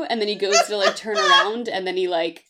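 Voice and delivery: normal talking voice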